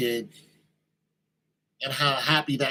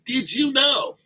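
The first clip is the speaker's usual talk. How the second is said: eager teaser; 9 semitones higher, 5 dB louder, slower than usual. Pretend. Speaker voices eager fact-teller.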